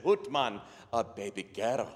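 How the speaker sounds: subdued Scottish father, falling away; deflated Scottish reply; Scottish brogue. Acted. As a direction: echo the news underwhelmed, in a put-on Scottish brogue. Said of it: "best Scottish brogue I can come up with".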